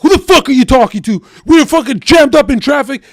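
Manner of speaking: high, loud angry yell; yelling back at the buddy in traffic; retold yell. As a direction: shout back in a fury. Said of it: "And I'd be like".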